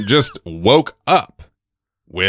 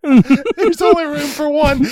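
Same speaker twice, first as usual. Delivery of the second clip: high snake voice; slower than usual. Retold or imitated.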